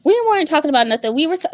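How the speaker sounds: fast, loud repeat of the denial; loud playful denial. Real, not acted. Insisting to her sister they weren't talking, loud repeat. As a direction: call it again quick and loud.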